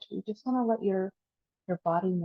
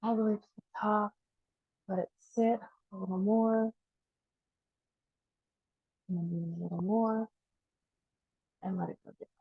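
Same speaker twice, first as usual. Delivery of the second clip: easing softer as each breath is let go; guided breathing meditation; 3 dB quieter than usual. Acted. Teacher counting down the last breaths, guided meditation.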